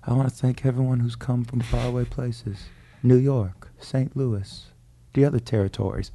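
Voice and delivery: soft, lightly voiced mafia boss; a hint of a Godfather welcoming the families; mild Godfather impression. Uncle addressing the wedding like a mob boss, softly. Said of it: "like The Godfather for a second".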